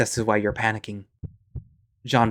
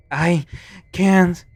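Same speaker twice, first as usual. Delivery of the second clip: desperate protest; 7 semitones higher, 6 dB louder than usual. Acted.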